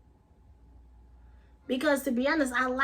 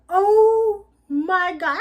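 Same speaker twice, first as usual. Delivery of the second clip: drawn-out, higher, louder oh my God; shocked gasp; 6 semitones higher, 8 dB louder, much slower than usual. Retold or imitated. Vlogger reliving the shock of his vision, slow loud disbelief. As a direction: stretch it slowly in loud disbelief. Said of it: "I was like".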